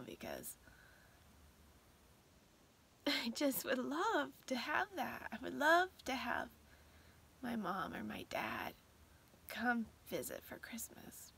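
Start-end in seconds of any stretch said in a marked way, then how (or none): 3.1-11.3 holding back tears, words fading to a whisper; longing that chokes her up; tearful, voice breaking. Real, not acted. To viewers, missing her late mom and dad, tearful. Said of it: "that's why I'm all emotional".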